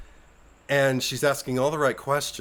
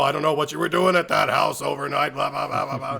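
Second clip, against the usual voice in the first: mock scolding parent; 3 semitones higher, 5 dB louder than usual.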